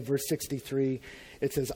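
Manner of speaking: ordinary talk, nothing marked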